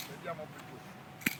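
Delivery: soft, slow praise to a dog. Real, not acted.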